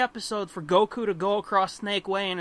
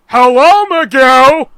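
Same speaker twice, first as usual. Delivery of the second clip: loud, higher, cartoonish hello; redubbed Charlie greeting; 4 semitones higher, 17 dB louder, slower than usual. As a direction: belt out a cheery dubbed greeting. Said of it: "and you'll be like".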